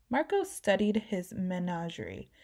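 plain reading voice

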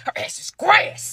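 slow, whispered threat; menacing whisper. Real, not acted. Anger taking over.

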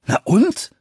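high, loud, irritated retort; annoyed and confused. A narrator's reading. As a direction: throw it back in exasperation. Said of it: "sagte er verärgert und verwirrt". (verärgert und verwirrt)

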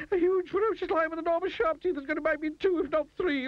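very high, escalating hysterical babble; shrieking terror. A narrator's reading. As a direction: work yourself into a faint over a lion. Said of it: "shrieked Mister Jelly".

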